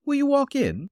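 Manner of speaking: high, smiling invitation; smiling landlady. A narrator's reading. Landlady inviting the detective inside, smiling. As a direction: welcome him in with a smile.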